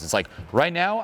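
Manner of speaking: ordinary talk, nothing marked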